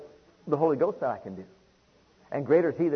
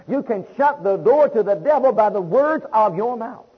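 louder, driving preacher's exhortation; preaching exhortation; 4 semitones higher, 5 dB louder than usual. Acted. Preacher urging the church to keep speaking it, fervent. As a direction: press the point home to the congregation.

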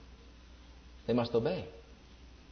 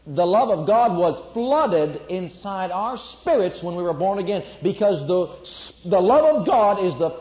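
louder preacher's build, driving each phrase; full-force preaching; 3 semitones higher, 5 dB louder than usual. Acted. Preacher to congregation, full-force preaching. Preaching about love filling the heart.

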